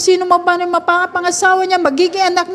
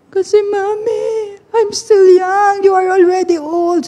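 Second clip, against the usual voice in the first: imitated crying child; 4 semitones higher than usual. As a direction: wail like a tearful kid to Mommy. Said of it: "Umiyak po siya nang umiyak". (ginagayang batang umiiyak)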